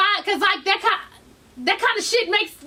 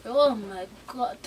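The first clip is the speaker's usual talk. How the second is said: put-on low, slow, soft accented drawl; low, lingering accent imitation; local men's accent; 6 semitones lower, 5 dB quieter, slower than usual. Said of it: "'cause they said".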